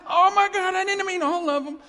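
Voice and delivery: hammed-up panicked yell, very high and loud; comic alarmed shout. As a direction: shout in mock horror at your mistake. Acts comic alarm at mass resurrection.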